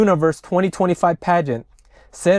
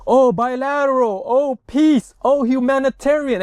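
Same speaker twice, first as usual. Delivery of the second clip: mocking; 7 semitones higher than usual. Mocking Thai officials' talking points.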